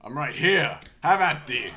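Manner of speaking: loud, high mock-heroic taunt; stagey fake archaic declaiming. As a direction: hurl it like a storybook knight.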